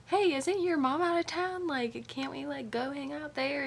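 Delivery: high, light put-on voice; casual distracting suggestion. Gipsy tries to distract Johnny, casually upbeat. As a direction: pitch it up, breezily changing the subject.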